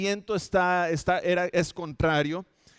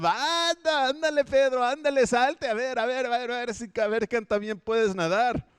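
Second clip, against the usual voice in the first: much higher, mocking goading; teasing disciples; 6 semitones higher than usual. The other disciples taunt Peter from the boat, mocking and high. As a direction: egg him on, jeering.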